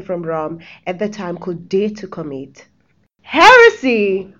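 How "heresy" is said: loud, drawn-out dramatic proclamation; dramatic shout. Acted. Narrator reveals the heinous crime to listeners, dramatic shout. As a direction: cry out the crime, higher and slow. Announcing the dreadful crime in skit.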